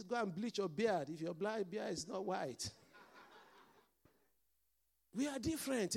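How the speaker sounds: ordinary delivery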